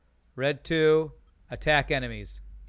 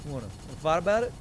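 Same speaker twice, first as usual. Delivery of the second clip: quick, hammed-up tough-guy challenge; mock street tough picking a fight; gangster voice; 2 semitones higher, much faster than usual. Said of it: "that was my gangster voice".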